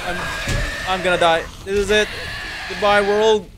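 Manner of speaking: loud panicked wail; scared horror game streamer. Real, not acted. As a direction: yell in terror, giving up. Chased by monsters.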